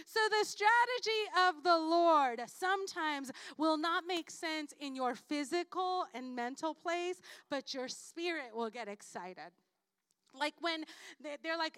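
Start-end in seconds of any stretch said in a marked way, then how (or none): none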